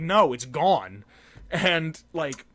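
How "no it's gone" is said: drawn out slowly; slow and final; slow firm statement. Retold or imitated. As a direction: spell it out slowly, deadpan. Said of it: "I'm like".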